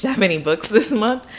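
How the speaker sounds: usual speaking voice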